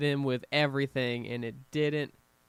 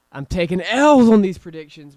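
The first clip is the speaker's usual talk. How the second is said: much louder, higher exclamation; excited outburst; 5 semitones higher, 18 dB louder than usual. Real, not acted. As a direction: shout it out, laughing at himself. Result surprises him.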